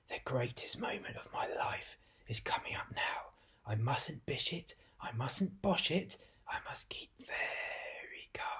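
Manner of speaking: soft whisper, nerves showing; nervous whisper. Acted. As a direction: whisper to yourself to stay calm. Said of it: "he whispered to himself under his breath".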